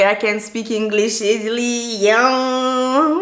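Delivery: drawn-out, playful English show-off, rising loud at the end; mock boasting. Bragging to viewers about her improved English, hammed-up. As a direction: belt out a slow, hammed-up brag.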